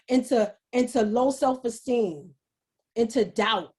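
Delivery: normal talking voice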